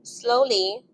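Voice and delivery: ordinary delivery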